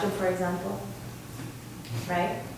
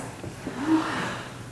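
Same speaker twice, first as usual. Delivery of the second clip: sharp unvoiced gasp of surprise; breathy surprised gasp; 4 semitones higher, 10 dB quieter than usual. Shown as a demonstration. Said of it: "how about a bigger surprise".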